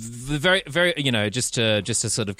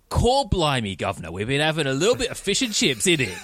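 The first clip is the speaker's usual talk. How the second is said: put-on Cockney accent; 7 semitones higher than usual. Podcaster hamming up a Cockney accent for his cohost. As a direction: ham up a Cockney chip-shop accent. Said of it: "in your English accent".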